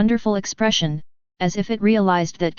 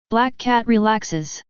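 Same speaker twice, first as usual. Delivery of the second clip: usual speaking voice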